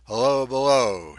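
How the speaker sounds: calling down. A narrator's reading. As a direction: call down from the deck, loud and high. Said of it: "he called down the open hatch".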